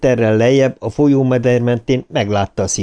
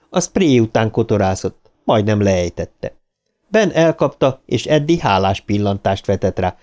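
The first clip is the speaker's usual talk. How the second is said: usual speaking voice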